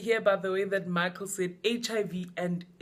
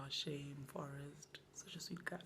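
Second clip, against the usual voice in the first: very soft, breath-only murmur; whispered sympathy; 7 semitones lower, 21 dB quieter than usual. Real, not acted. Host pitying Forrest Gump, whispering. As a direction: whisper it as a little aside of pity.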